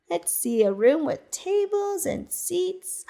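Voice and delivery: ordinary delivery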